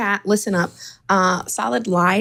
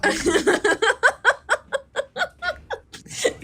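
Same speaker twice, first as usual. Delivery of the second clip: long, high peal of laughter. Real, not acted. Friend cracking up at the telling-off, long high laugh. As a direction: crack up helplessly.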